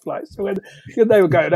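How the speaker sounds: usual speaking voice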